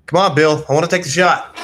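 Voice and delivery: ordinary delivery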